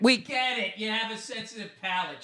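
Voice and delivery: high, soft sneer; sarcastic put-down. Real, not acted. Scoffing at a cigar reviewer's palate. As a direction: roll your eyes at him. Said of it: "mocking the reviewer".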